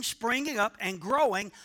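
normal talking voice

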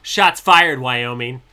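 loud, playful challenge shouted at Wyoming; mock call-out. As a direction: yell it like a fake feud declaration. Jokingly calling out a state.

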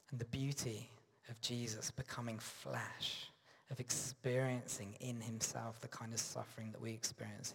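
soft, near-whispered comfort; tender hush. Real, not acted. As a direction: lean in and speak softly to the hurting. Intimate comfort to suffering listeners.

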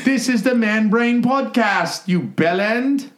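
slow, very high hammed-up Aussie; stretched-out cartoon Australian; Australian-sounding accent. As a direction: announce it in a botched British-gone-Australian accent. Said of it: "That's Australian".

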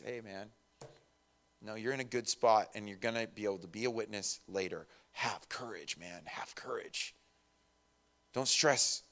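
soft, hushed comforting voice; calm him like a friend; reassuring whisper. Retold or imitated. The Lord comforts a doubting Paul, soft reassuring hush. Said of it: "and says".

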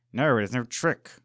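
loud, furious denial through clenched teeth; seething anger. A narrator's reading. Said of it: "he seethed".

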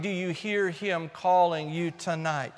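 ordinary talk

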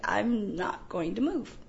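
slow, soft, spent delivery; drained refusal. Retold or imitated. Exhausted producer slumped in corner, quietly refusing. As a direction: say it quietly, completely done.

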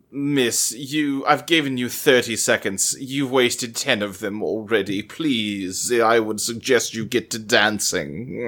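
deep disdainful judge brushing off a late dancer; stern judge. Retold or imitated. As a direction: play the jerk ballet judge, low and dismissive. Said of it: "she goes".

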